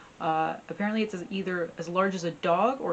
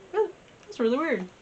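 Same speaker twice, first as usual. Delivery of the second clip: mildly puzzled; 9 semitones higher than usual. Acted. Farmer reacting to cats with coffin.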